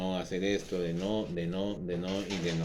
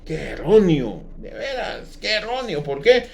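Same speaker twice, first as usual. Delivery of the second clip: loud, fervent pulpit delivery; fiery sermon voice; preaching at full force; 5 semitones higher, 8 dB louder than usual. Acted. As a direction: thunder it out to the congregation.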